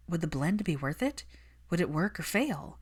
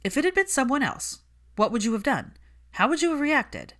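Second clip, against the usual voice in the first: pressing insistence; 8 semitones higher, 5 dB louder than usual. Acted.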